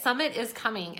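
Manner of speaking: ordinary talk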